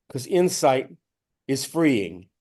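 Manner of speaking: normal talking voice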